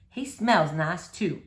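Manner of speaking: preacher's voice. Acted.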